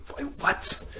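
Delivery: breathless, whispered disbelief; a whispered, floored "what"; stunned whisper. Retold or imitated. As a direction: barely get the word out, shocked. Stunned reaction to being cut.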